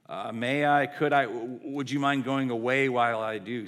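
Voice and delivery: put-on tentative girl stumbling over her words; hesitant request. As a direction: stammer through a polite, uneasy request. Timid girl asking a lion.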